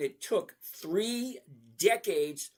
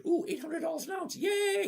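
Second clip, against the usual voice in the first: mock excited viewer; 13 semitones higher than usual. Mocking a viewer who cheers as gold shoots up. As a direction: play a giddy gold buyer cheering. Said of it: "And you're probably thinking".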